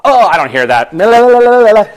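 loud la-la-la drowning someone out; mock childish blocking-out, loud and rising high; refusing to listen. Younger self fighting off the Lord's correction, loudly not listening.

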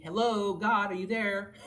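acted "is anyone home" call. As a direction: call out as if nobody is answering. Acting out calling to absent God.

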